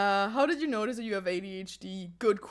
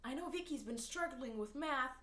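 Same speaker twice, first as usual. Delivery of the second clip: acted teacher; 5 semitones higher, 6 dB quieter than usual. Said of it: "the teacher in the background".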